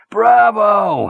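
a loud, high cheer; hearty bravo. A narrator's reading. Gallagher slapping Chandler's back over his son, loud high cheer. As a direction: cheer him on like a proud pal.

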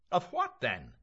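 high, loud demand; sharp baffled question; exasperated Mulligan. Acted. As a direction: pitch the question high, then drop. Mulligan asks back, exasperated.

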